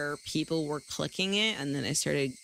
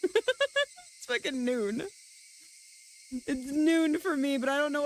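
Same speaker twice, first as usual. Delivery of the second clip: laughing; 8 semitones higher than usual. Pretend. Teasing viewer waking late.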